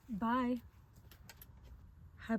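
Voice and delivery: ordinary talk, nothing marked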